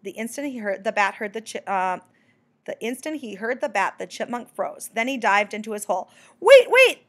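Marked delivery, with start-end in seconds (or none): 6.4-7.1 loud, high-pitched urgent call; crying out. A narrator's reading. Bat cries after the diving chipmunk, loud and high. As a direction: shout after someone fleeing. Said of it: "the bat cried".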